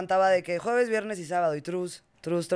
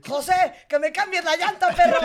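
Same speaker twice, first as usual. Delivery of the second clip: mock yell; 9 semitones higher, 4 dB louder than usual. Joke yelling at a servant.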